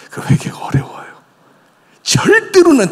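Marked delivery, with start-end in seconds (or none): 0.1-1.1 hushed whisper. Real, not acted. Preacher whispers to the congregation. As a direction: lean in and whisper it.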